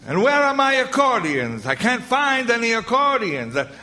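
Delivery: lightly voiced [name] accent; a hint of Welk's genial bandleader lilt. Bandleader to his band, a mild Welk accent. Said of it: "the champagne music of [name]".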